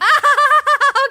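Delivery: loud, high burst of laughter; awkward laugh on being caught out; nervous laugh. Acted. Learns mic was recording.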